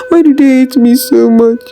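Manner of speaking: high, tearful pleading sobs; weeping alone in the dark, asking why; crying. Acted. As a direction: cry out your hurt into the night. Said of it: "she cried".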